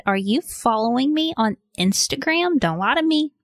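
high, sing-out mock scolding; playful accusation. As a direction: tease the listener, pitch swooping up high. Mock scolding in host's promo.

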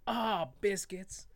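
slow "Ah, biscuits"; mild dismay. Shown as a demonstration. Dad showing the clean swear he uses, mildly annoyed. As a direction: draw it out like a gentle curse. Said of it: "I just gotta be like".

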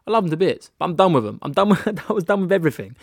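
loud, high venting with a laugh; emphatic, exasperated outburst; fed-up declaration. Retold or imitated. Retelling being fed up with people.

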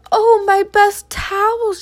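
high, loud, drawn-out dismay; dismayed exclamation. A narrator's reading. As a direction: wail over something precious that is ruined. Woman finds her towels ruined.

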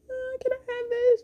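put-on high-pitched little boy pleading; whiny child. Little boy asks mom for a toy, whiny. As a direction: put on a tiny high kid's whine. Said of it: "he was kind of whining".

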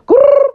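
loud, high rolled growl; high trilled grrr, played for laughs; playful growl. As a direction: let out a mock "grrr". Comic growl between remarks.

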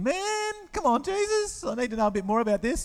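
high, fast fidgety pleading; impatient Thomas. Retold or imitated.

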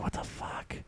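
breathy unvoiced whisper of disbelief; hushed what-the-fuck; whispered aside. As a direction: mutter it under your breath, stunned. Whispered aside in retold story.

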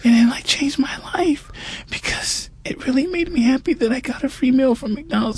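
soft whispered joy; almost whisper the happy ending; hushed confession. Real, not acted. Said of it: "hushed tender confession".

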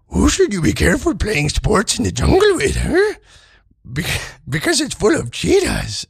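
high, put-on joke-telling voice; hammed-up kids' joke voice; jokey put-on voice. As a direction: read the joke in a silly high voice.

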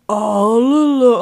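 slow, loud, warped demon cry; distorted demonic voice. Retold or imitated. Retelling the girl's voice turning demonic, loud and warped. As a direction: let the voice tear into something demonic. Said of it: "Her voice gets so distorted".

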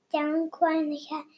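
ordinary delivery

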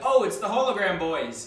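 "hologram" called out high and loud with a long "ho-lo"; mispronounced "hologram". Retold or imitated. Hiker greets the hologram boys, mock call with odd pronunciation. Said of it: "he pronounced it".

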